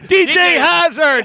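loud, slow hype announcement; announcer call. Acted. Cohost hyping the guest's entrance, loud and drawn out.

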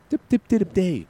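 high quick mock beeping; imitated beeps. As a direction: chirp it like a strange signal. Imitating a noise in headset.

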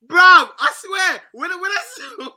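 high-pitched, laughing disbelief; excited. Real, not acted. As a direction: squeal it out in disbelief. Excited retelling about the tweet.

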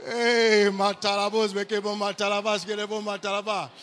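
rapid run of ecstatic syllables; speaking in tongues. Real, not acted. Preacher breaking into prayer, speaking in tongues. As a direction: burst into tongues, loud at the start.

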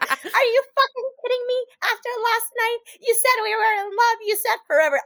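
imitating a high-pitched, outraged meltdown; mock hysterical accusation; losing it. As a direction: act out her indignant outburst, high and heated. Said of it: "she starts fucking losing it".

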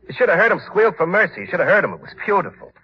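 high-pitched, crazed relish; gleeful gloating. Acted. Eddie boasting to Doc about the killing, high glee. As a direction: relive it with excited delight. Killer relives the murder.

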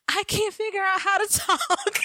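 very high, laugh-choked plea; high-pitched and cracking up; laughing mock panic. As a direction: squeal it through helpless laughter. Cracking up acting out voiceless panic.

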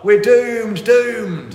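loud, high wail of doom; gloomy doom-monger. Retold or imitated. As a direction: moan that all is lost, full volume. Quoting Dad's Army doom-monger.

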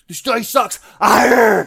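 high, loud raging yell; angry shout. Retold or imitated. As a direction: yell at the dice in a rage. Raging player yelling at dice.

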